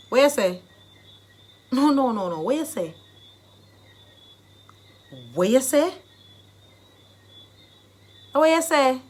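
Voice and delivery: loud rising challenge in Jamaican patois. Shown as a demonstration. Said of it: "depends on the mood that you're in".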